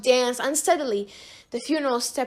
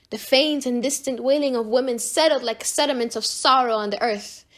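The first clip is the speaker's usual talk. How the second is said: usual speaking voice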